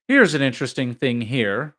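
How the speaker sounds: loud, determined attempt to interest; valiantly entertaining. A narrator's reading. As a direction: try hard to entertain the company. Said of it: "endeavoring valiantly to entertain".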